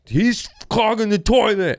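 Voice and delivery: high-pitched tattling yell; hammed-up, very high complaint; mock outburst. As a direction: blurt out the accusation in a squealed yell. Acts out outburst before the managers.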